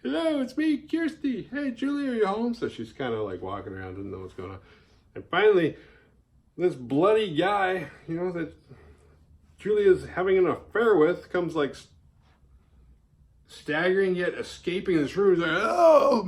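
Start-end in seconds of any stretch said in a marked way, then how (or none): 0.0-2.5 high, put-on chirpy "anyone home" voice; sing-song calling out. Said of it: "I don't wanna just start being like". 15.6-16.2 startled yelp. Retold or imitated. Guy caught escaping the room.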